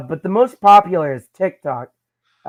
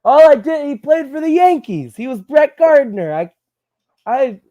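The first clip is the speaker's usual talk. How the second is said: indignant, higher-pitched protest; protesting fired doctor; 6 semitones higher, 4 dB louder than usual. Acted. Fired doctor arguing with his boss over a Yankees player, indignant. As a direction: plead your case in a raised, incredulous pitch. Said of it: "he would be like".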